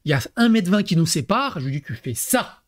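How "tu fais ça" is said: calm authority. Shown as a demonstration. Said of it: "L'autorité".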